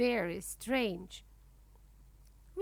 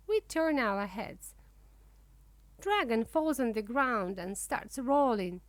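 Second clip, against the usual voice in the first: ordinary delivery, nothing marked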